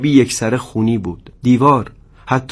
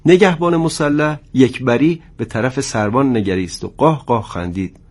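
ordinary delivery

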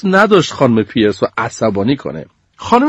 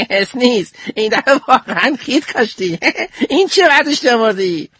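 high, mocking kid voice; mocking classmate; 10 semitones higher than usual. Ice mocks Sneeze's frozen chicken in a high mocking voice. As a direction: tease him from up high.

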